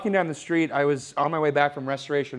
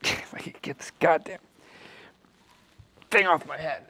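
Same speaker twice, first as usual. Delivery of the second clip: straining; 3 semitones higher than usual. Real, not acted. Struggling to pull helmet off.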